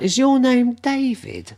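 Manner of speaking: high, slow, drawn-out question; drunk woman impression. Drunk woman asking a stranger his name, high slurry mimicry. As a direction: imitate a tipsy woman squealing a slow question.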